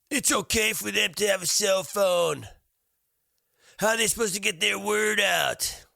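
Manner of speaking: high, loud mocking of a whiny woman; mock opponent. Mimicking the woman's online reply in a high indignant voice. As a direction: squeal her reply back high and indignant. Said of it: "she writes back".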